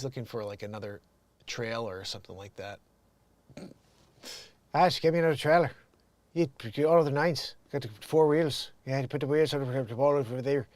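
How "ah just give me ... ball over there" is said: mumbled mock Irish accent; Brad Pitt in Snatch. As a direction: garble it in a thick Irish brogue. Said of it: "his accent is hilarious in that movie".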